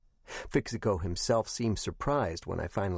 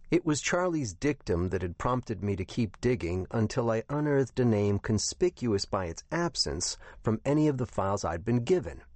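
plain reading voice

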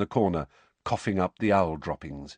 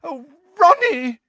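high, loud gushing cry; gushing mother; 9 dB louder than usual. Acted. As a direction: squeal over her son. Mother gushing over prefect son.

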